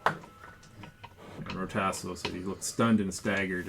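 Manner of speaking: ordinary delivery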